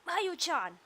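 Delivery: high, urgent call across the room; alarmed cry. Acted. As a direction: cry out to stop her. Character cries out in alarm.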